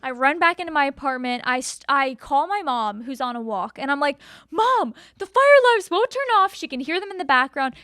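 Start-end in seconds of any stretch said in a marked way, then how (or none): 4.5-6.5 much higher, louder, frantic voice; a stressed daughter begging for help; panicked plea. Retold or imitated. Retelling the call to her mom about the alarms, in a frantic higher plea.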